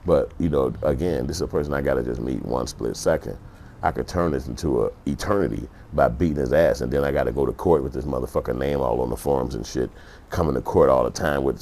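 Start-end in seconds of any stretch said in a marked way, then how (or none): none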